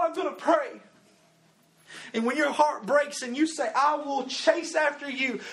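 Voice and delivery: loud, high, driving preacher's cry; preaching at full force. Acted. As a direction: preach it hard to the youth crowd. Preacher rises to full force again.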